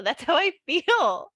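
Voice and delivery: slow, high, wobbly snail voice; slow little snail, close to laughing. Pretend. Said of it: "Can you do the voice of the snail?".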